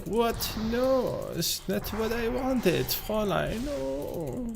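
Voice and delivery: high, soft mock-German whine; Albert. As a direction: plead in a badly faked German accent. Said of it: "Then you're gonna go like".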